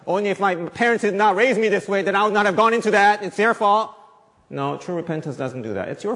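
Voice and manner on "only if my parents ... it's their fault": higher, louder, fast whining excuse; mock blamer. Preacher mocking someone blaming their parents, high and loud. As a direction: rattle off the excuse like a sulky child. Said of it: "Only if I wasn't born".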